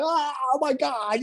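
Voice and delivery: high, drawn-out mock panic wail; freaking out. Husband mimicking his wife after the deer crash, mock hysterical wailing. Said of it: "like, lost her shit".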